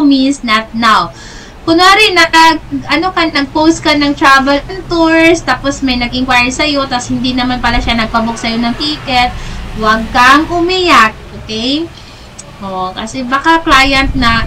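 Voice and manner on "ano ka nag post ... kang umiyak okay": ordinary talk, nothing marked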